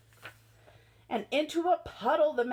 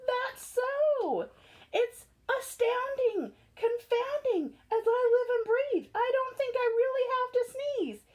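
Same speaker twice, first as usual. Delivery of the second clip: amazed elephant; 8 semitones higher than usual. Acted.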